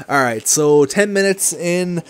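ordinary delivery

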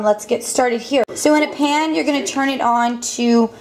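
usual speaking voice

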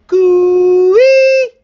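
very high, loud, long coo-ee; bush call. Real, not acted. Hiker calling out across the bush, long loud coo-ee. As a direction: call out across the valley so they hear you.

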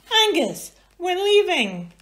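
high, loud call; high loud summons. Acted. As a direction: call him to come. Hamish calling out.

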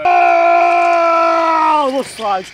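loud high alarmed cry; action-scene yell; shout. Acted. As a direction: call out in mock alarm. Shout during action take.

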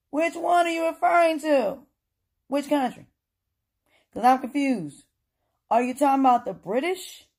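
high-pitched, insistent questions; exasperated questioning. Real, not acted. Pressing an absent speaker to be specific.

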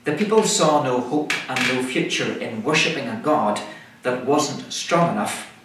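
ordinary delivery

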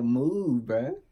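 put-on irritated kid brushing her off loudly; annoyed shove-off. Kid pushing a pestering girl away, annoyed and loud. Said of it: "I'm like".

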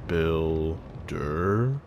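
eerie phantom calling from afar; spooky voice. Acted. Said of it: "What the heck was that spooky voice?".

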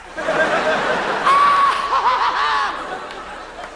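long, high crazed laughing wail. Acted. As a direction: burst into an over-the-top mad laugh. Mock-crazed laugh after kicking cat.

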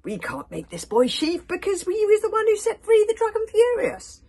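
loud, high-pitched protest; heated objection. Acted. Warrior arguing against the boy as chief, heated objection. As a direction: argue it hotly, voice climbing high.

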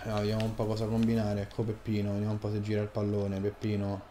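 low, hushed play-by-play; sports commentary. Pretend. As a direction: murmur the action like a TV commentator.

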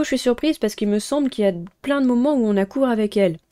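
ordinary delivery

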